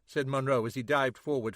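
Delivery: usual speaking voice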